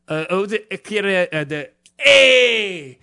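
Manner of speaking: slow drawn-out hey shout; hamming up a foreign cry; fake foreign shout. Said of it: "fucking Eastern Europeans".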